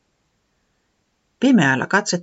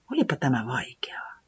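soft whisper to oneself; whispered thought; 2 semitones lower, 8 dB quieter than usual. Acted. Tiputi thinking to herself in a whisper. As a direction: whisper the worry inward.

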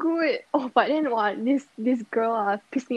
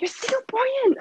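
retold shout; 11 semitones higher than usual. Insisting to someone of mixed parentage, mock shouting. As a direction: imitate someone exclaiming in disbelief. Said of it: "this person actually shouted".

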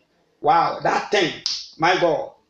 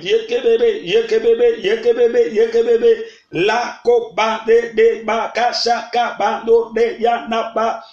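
higher-pitched rapid-fire syllables in tongues; speaking in tongues; 4 semitones higher than usual. Real, not acted. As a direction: pray fervently in tongues, punching repeated syllables. Preacher bursts into tongues.

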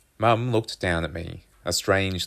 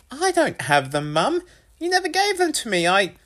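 put-on high, louder boy's protest; defensive son; 5 dB louder than usual. A narrator's reading. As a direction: protest your innocence, rising high until cut off. Boy defends himself, is cut off.

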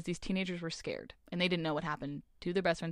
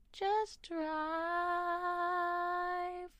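dreamy [name]; 11 semitones higher, 4 dB quieter than usual. Retold or imitated. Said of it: "Like [name] says".

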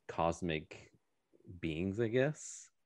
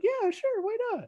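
very high, light voice for the wife; high mock wife voice. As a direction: squeak it like a cheerful wife. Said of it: "she's like".

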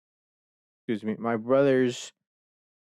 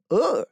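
high mock "ugh" of disgust; retold disgusted groan; 12 semitones higher, 4 dB louder than usual. Brother reacting to the new keyboard with a high mock groan. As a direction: imitate the brother's grossed-out groan. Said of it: "He's like".

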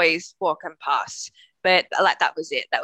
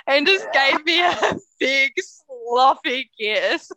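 laughing; 7 semitones higher, 3 dB louder, slower than usual. Real, not acted. Laughing telling of the kiss.

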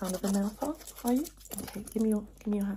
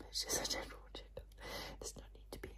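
very soft, quick whisper; reassuring whisper; 15 dB quieter, faster than usual. Pretend. Doctor calming patient about a checkup, whispered. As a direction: whisper it as comfort.

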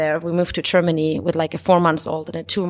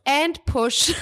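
doctor's quote; 6 semitones higher than usual. Retold or imitated. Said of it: "the O B G Y N goes".

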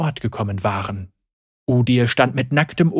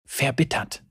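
murmuring voice; 7 dB quieter than usual. A narrator's reading. Murmuring voices in his head.

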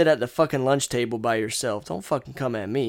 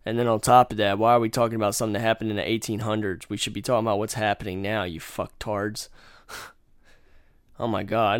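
ordinary delivery

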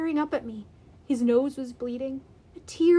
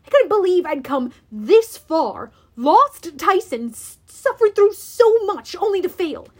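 loud, raised-pitch outrage; swelling anger; 5 semitones higher, 7 dB louder than usual. A narrator's reading. Hero rages at having lost so much, swelling anger. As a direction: let the frustration surge through each phrase. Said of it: "my anger swelled".

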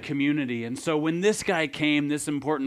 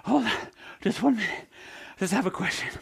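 mock breathless; 7 semitones higher than usual. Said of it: "He's out of breath".

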